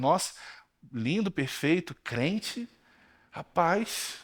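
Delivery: soft, slow teasing echo of her praise; mock amazement. Pastor teasing the girl about her perfect man, soft mock awe. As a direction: repeat her words quietly, playing astonished. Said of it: "já começa as brincadeiras dele".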